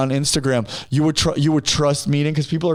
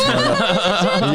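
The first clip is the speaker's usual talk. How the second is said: high laughing tease. Real, not acted. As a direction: squeal the name through laughter. Teasing name, laughing.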